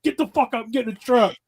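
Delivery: high-pitched bossy order to a friend; retold yell. Telling Sean to get in the truck, mock yell. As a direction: push your buddy into the truck, half-joking. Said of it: "I tried that with Sean".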